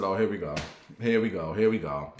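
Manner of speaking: weary here we go. Retold or imitated. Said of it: "I said".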